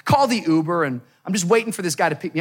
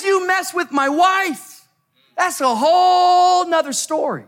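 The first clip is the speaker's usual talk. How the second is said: full-force preaching; 10 semitones higher than usual. Real, not acted. Preacher vowing to protect his wife, full-force preaching. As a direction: build it like a fervent sermon, stretching key words.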